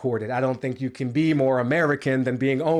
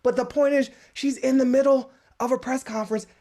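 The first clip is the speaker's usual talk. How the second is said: normal talking voice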